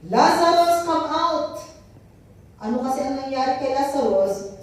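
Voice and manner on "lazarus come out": a loud summons, higher and louder; loud call. Acted. Jesus calling Lazarus out of the tomb, loud call. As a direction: call him out of the tomb at full voice. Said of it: "called in a loud voice".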